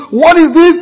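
"What is this?" high and loud, rising; loud, high, agitated question; mixed feelings. Retold or imitated. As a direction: push it out, half indignant. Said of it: "And if it goes mixed".

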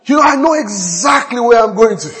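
loud, high preacher's proclamation; preaching at full force. Acted. Pastor proclaiming to his church, loud and high. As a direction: preach it out to the congregation.

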